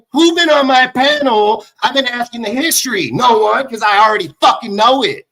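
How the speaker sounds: high, loud angry protest; indignant outburst. Real, not acted. Indignant rant about his panel.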